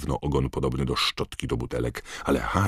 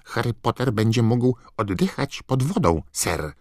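high, squeaky elf voice; Dobby house-elf voice; 9 semitones higher than usual. A narrator's reading. Dobby explaining. (wysoki, piskliwy głos skrzata)

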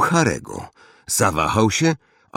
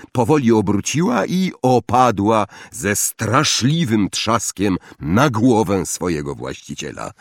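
usual speaking voice

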